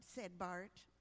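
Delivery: usual speaking voice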